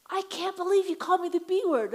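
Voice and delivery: high, loud indignant outburst; retold angry woman. Woman at the bird show, loud and indignant. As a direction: shriek it like an offended mom. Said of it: "and then the woman says".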